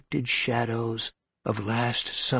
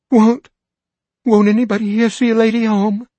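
much higher, louder faltering plea; begging with stuttering words; trembling plea; 12 semitones higher, 12 dB louder than usual. Acted. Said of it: "her lips began to tremble".

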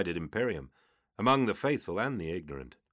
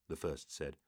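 plain reading voice

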